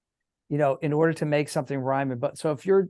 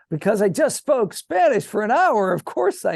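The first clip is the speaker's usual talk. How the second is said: raised, indignant protest; exasperated retort; 7 semitones higher, 6 dB louder than usual. Retold or imitated. A student snapping back at the French teacher, exasperated. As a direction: defend yourself, louder and higher. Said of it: "I'm like".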